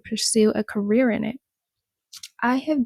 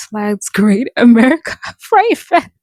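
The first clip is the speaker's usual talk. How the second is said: louder as laughter takes over; breaking into laughter; 9 dB louder than usual. Real, not acted. Host reads interview about Fright Fest, breaking into laughter. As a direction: lose it laughing while reading aloud. Breaks up reading article, then apologises.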